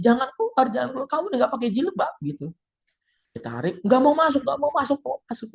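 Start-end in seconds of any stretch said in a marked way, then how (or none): 0.0-2.1 high, fast urgent pleading. Retold or imitated. Friends pulling her back. 3.8-5.5 high whining refusal; high pitched protest; whiny refusal. Retold or imitated. Possessed woman refusing to enter.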